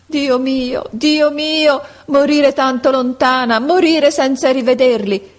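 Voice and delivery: high, loud anguished lament; desperate cry. Acted. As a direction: cry out in despair for the lost children. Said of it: "con un atto di disperazione... e gridava". (grido disperato e straziato di madre morente)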